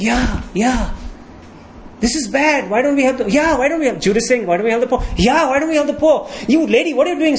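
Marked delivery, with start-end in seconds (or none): 0.0-4.0 mock chorus of agreement, pitched up; indignant agreement. Disciples chiming in after Judas, indignant agreement. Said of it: "they're like". 4.5-7.4 acted indignation, raised pitch; mock a crowd piling on in a higher voice; indignant scolding. Disciples turning on the woman, indignant scolding. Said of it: "they get indignant and they start scolding".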